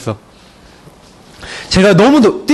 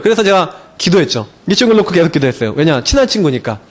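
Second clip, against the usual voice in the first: usual speaking voice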